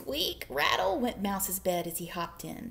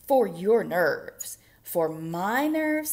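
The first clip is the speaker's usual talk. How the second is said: slow; 7 dB louder, much slower than usual. Acted. Slow bear and mouse.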